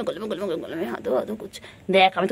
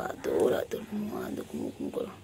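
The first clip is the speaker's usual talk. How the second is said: lulling whisper; 9 dB quieter than usual. Real, not acted. Lulling pet to sleep.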